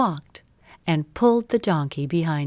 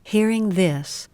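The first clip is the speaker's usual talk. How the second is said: usual speaking voice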